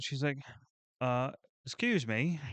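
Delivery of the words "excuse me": lightly attempted Australian accent, pitched higher; amateur imitation of an Australian woman. Australian wife politely approaching him as he shovels, rough Australian accent. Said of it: "in a Australian accent".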